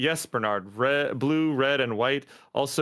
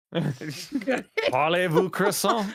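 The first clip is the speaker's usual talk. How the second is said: put-on very slow, hammy French accent; 5 semitones higher, much slower than usual. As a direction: ham up the French. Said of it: "none of us can do a French accent".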